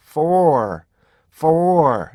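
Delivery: for loud and long, its parts joined; slow, high, connected for; linked for. Shown as a demonstration. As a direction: stretch for, linking each part. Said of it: "이걸 연결한 거예요".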